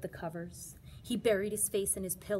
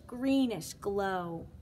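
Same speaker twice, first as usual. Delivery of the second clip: usual speaking voice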